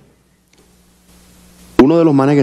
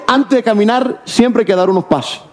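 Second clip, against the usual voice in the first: much higher, put-on [name] voice; solemn saying done as [name], pitched up; [name] impression; 10 semitones higher than usual. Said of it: "[name] un día tiró una". (voz de [name], aguda y solemne)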